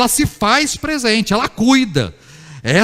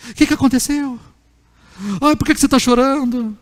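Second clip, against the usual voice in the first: consoling mother; 4 semitones higher than usual. Acted. Mother asking her crying child what happened, higher-pitched cooing. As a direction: fuss over a hurt child in an imitated mother's voice. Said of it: "Como é que a mãe surge?".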